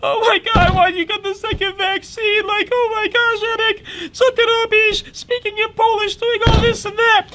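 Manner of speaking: high-pitched frantic mom voice, partly in Polish; imitating mom. Son mimics his panicking mother after fake-sick prank, high frantic voice. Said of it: "She's like".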